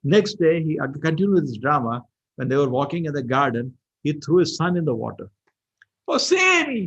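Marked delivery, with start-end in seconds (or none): 6.1-6.8 very high, loud acted wail; cry for help. King's son thrown in water, acted cry for help. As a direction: call out for rescue, high and drawn out.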